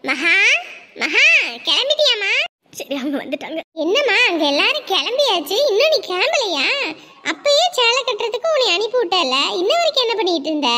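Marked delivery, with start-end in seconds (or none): 0.0-2.3 louder call through the house; mother calls the girl loudly. Acted. Calling daughter from outside room. 3.8-10.7 loud scolding. Acted. Scolding the delayed bride.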